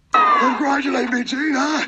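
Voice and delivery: triumphant taunt, high-pitched; gloating outburst. Real, not acted. Gloating at interviewer after attack.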